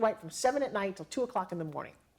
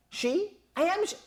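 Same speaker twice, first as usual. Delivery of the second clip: high, mock-offended protest; indignant disbelief; 10 semitones higher, 3 dB louder, slower than usual. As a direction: pitch it up, pointing at herself in disbelief. Indignant reply about herself.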